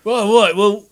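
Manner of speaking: high, loud comic caller after a tool; loud, high-pitched skit character; baffled shed visitor. Acted.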